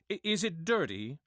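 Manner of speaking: ordinary talk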